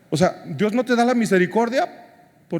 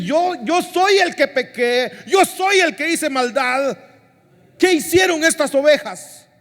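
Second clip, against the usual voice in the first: anguished confession; 9 semitones higher than usual. Acted. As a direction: cry it out as guilty David owning his sin. David confesses, preacher acting it out.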